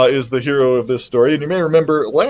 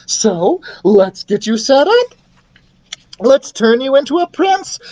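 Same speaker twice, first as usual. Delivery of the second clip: high, bustling enthusiasm; rally eagerly, pitched up; excited queen; 9 semitones higher than usual. Acted. Queen readies son for training, excited.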